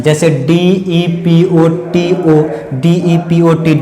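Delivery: usual speaking voice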